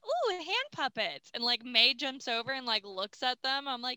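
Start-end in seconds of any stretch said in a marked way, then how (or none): 0.1-1.3 high-pitched, playful oohing; put-on ooh of fascination; mock wonder. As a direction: imitate being delighted by a novelty. Retells characters' reaction to puppets.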